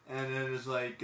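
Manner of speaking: ordinary talk, nothing marked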